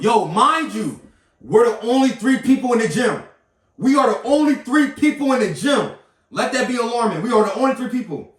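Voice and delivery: loud, high, incredulous emphasis; emphatic. Real, not acted. Customer stressing how empty the gym was, loud and emphatic. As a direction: raise the voice and punch each phrase. Emphatic aside, telling the dispute.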